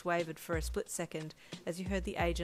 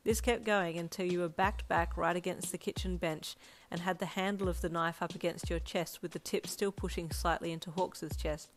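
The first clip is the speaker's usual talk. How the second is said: ordinary delivery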